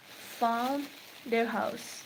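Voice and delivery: ordinary talk, nothing marked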